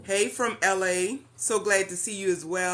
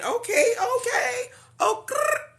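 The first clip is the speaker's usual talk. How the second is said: squeaky playful okay; 4 dB louder than usual. Real, not acted. Reacting playfully on a live stream.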